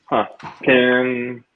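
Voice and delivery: ordinary talk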